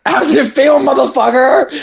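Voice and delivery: high-pitched victorious jeer; hammed-up, high mocking cry; gloating taunt. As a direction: crow it at the captured enemy. Acted taunt of captured bug.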